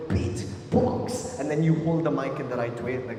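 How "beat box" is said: breathy beat box drum hits; beatbox sounds. Shown as a demonstration. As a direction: beat box as percussion, barely voiced. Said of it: "You're going".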